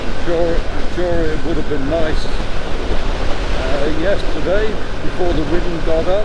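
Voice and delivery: muffled through a helmet mic; riding with wind noise; inside a helmet. Real, not acted. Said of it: "I hope there's not too much wind noise going into the microphone".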